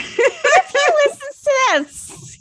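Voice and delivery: high, loud laughing protest; embarrassed laughing squeal. Real, not acted. Host teased about a storyteller she likes, real embarrassed squeal. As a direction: squeal it through laughter, drawn out.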